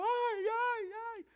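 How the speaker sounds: very high, loud wails; hammed-up screams of alarm; panicked screaming. People in a storm-tossed boat screaming, panicked wail. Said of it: "la gente gritando".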